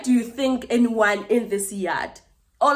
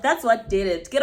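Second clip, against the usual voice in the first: normal talking voice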